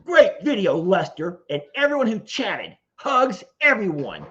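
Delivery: high, hammed-up mock shout; all-caps voice. Streamer acting out an all-caps chat comment, mock shouting. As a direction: punch it out as if typed in capitals. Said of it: "Here, I'll do it again".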